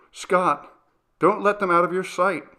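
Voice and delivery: alarmed, pressing him to act; anxious warning. A narrator's reading. Frightened mother warning her husband about the children, urgent.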